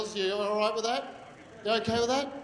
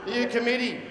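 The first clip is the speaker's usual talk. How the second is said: ordinary talk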